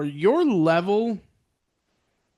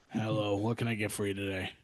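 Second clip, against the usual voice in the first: lower, much softer order taker; calm second voice; 7 semitones lower, 10 dB quieter than usual. Retold or imitated. Replacement worker takes the order, low and soft. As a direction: drop into a calm, subdued worker voice.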